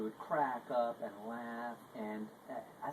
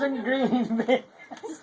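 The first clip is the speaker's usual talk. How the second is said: much higher, much louder, slow teasing singsong; hammed-up clown boast; 14 semitones higher, 18 dB louder, much slower than usual. Clown teasing a hungry hospital patient, loud slow mock boast. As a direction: lay it on thick, booming and drawn out to tease.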